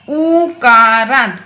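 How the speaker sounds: उकारांत said slowly, its opening उ stretched long; drawn-out उ sound. Shown as a demonstration. Teacher showing children the उ sound, उकारांत slow and drawn out. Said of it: "उ का उच्चारण है बच्चों".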